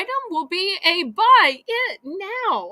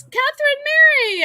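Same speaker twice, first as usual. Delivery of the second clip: delighted surprise, calling a name; surprised greeting; 11 semitones higher, 7 dB louder than usual. Real, not acted. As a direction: call her name, thrilled to see her. Surprised to see viewer.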